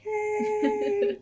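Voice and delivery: high, drawn-out mock cheer; playful little celebratory squeal; acted cheer. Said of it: "I'll scream for you".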